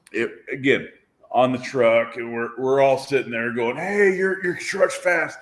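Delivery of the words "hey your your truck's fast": higher-pitched mock-excited quote; raised-pitch group exclamation, retold; retold excited call. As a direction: call it out like an impressed crew. Retold group calling out praise.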